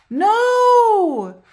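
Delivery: loud, high cry of no; shout. Acted. Buster shouting to stop Bridget, loud and high. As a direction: yell to stop her. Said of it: "shouted Buster".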